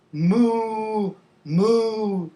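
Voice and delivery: long, steady moos; cow moo. Shown as a demonstration. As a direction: imitate a cow for the class. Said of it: "talk about the sounds".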